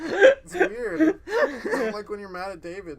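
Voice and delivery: laughing crying. Real, not acted. Laughing, teary protest.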